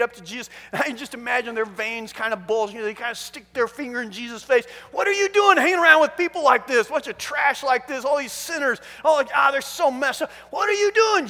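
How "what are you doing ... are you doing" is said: loud, high, outraged scolding; angry. Acted. Religious leaders confronting Jesus at the party, angry. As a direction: confront him, indignant and forceful. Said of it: "They are mad".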